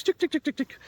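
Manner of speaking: high ticking like a hen; hen clucking sound. Shown as a demonstration. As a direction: imitate a scratching hen. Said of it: "that noise".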